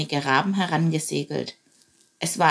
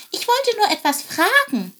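high sparrow voice; 14 semitones higher, 4 dB louder than usual. Acted. Chilp explains himself.

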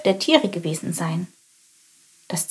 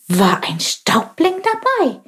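eager; 10 semitones higher than usual. Acted. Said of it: "fragte er gespannt".

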